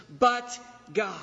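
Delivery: "but" punched loud, "God" falling off; emphatic but God. Shown as a demonstration. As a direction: land the contrast hard on "but". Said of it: "for the emphasis that Paul is trying to communicate".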